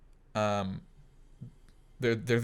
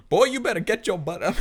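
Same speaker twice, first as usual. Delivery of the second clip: high, loud sassy scolding; sassy scolding mom; 11 semitones higher, 6 dB louder than usual. Retold or imitated. Obi-Wan scolding Luke like a sassy mom. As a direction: scold him like a fed-up mother.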